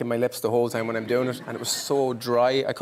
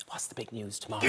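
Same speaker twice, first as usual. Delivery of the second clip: very soft, confidential question; hushed teacher; 3 semitones lower, 14 dB quieter than usual. Retold or imitated. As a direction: imitate a teacher fishing for a secret, hushed. Retold teacher's secret question to pupil.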